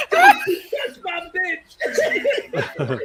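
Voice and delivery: slow, high mock-furious warning through laughter; laughing, hammed-up jealous yell; mock jealous anger. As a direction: drag it out, cracking up. Acts out jealous film character.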